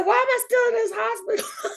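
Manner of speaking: high, crying plea from the hospital bed; confused waking plea. Retold or imitated. Patient waking from surgery asks why still here, high tearful cry. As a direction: cry out, bewildered, just woken.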